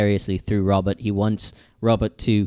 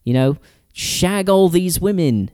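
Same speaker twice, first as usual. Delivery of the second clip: higher, hammed-up swinging-sixties British cad; Austin Powers-ish; 7 semitones higher, slower than usual. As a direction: egg him on like a randy Austin Powers. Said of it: "Drifting a little bit into Austin Powers territory".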